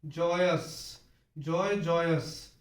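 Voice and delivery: जॉय and जॉयस said slowly, one after the other, the ending "स" drawn out; careful pronunciation. Shown as a demonstration. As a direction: spell out जॉयस slowly, as if teaching. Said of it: "stroke एस डालना पड़ेगा last में". (धीरे धीरे जॉयस का उच्चारण)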